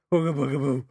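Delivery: hammed-up spooky ghost; mock ghost moan. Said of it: "doing this best spooky voices".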